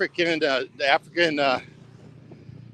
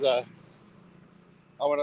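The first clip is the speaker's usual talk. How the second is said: ordinary delivery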